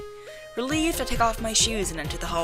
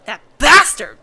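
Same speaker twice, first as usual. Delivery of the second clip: angry; 3 semitones higher, 11 dB louder, slower than usual. Acted. Angry outburst at driver.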